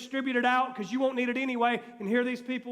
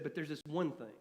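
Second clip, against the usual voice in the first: hushed; 8 semitones lower, 11 dB quieter than usual. Acted. Jesus quoted, hushed.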